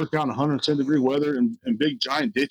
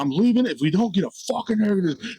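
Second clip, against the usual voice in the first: higher-pitched mock rant; retold angry outburst; 8 semitones higher than usual. Hot restaurant worker threatening to quit, retold as a high, mad outburst. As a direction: re-enact losing your temper, voice pitched up. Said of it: "I got mad one time".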